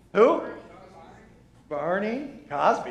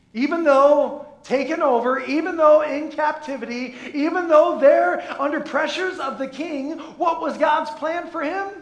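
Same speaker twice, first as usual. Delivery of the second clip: much higher and louder, building to a shout; rising preacher's cry; preaching at full force; 9 semitones higher, 9 dB louder than usual. Acted. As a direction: drive it home from the pulpit. Preaching crescendo.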